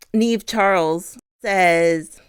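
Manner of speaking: usual speaking voice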